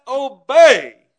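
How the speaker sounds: sudden preacher shout on "obey". Acted. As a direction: hit "obey" like a hammer. Preacher shouts key word.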